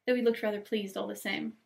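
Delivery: usual speaking voice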